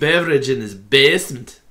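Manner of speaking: put-on Scottish accent. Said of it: "that is in fact a Scottish accent".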